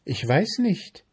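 high, light mayfly voice; airy mayfly. A narrator's reading. Mayfly answering the ant, artless.

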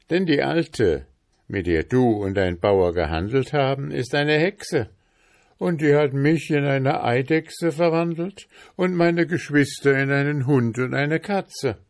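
high, animated account; freed prince. A narrator's reading. Prince explains the witch's spell, animated high voice. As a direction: tell it urgently as the prince.

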